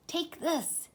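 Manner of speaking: lightly voiced high mouse. A narrator's reading. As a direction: hand it over in a small high voice. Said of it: "he said".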